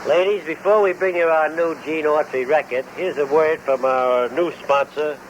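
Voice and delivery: ordinary talk, nothing marked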